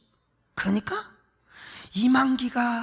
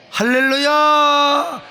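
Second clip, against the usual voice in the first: long, drawn-out shout of hallelujah; 4 semitones higher, 5 dB louder, much slower than usual. Real, not acted. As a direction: call it out slowly to the crowd. Preacher calls out to congregation. (길게 외치는 할렐루야)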